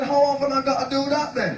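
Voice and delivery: high, put-on punchline voice; high-pitched comic caricature; Chalky character voice. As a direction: ask it high, as the clueless driver.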